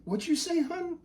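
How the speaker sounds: high, soft falsetto question; hushed, high-pitched confusion; puzzled mom. Acted. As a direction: gently query a spouse in a light wife voice. Mom puzzled by name read out.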